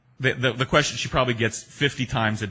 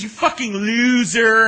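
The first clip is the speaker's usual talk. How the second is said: drawn-out, high, loud mocking "loser"; Wayne's World loser taunt; 12 semitones higher, 9 dB louder, slower than usual. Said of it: "was it Wayne's World that started this".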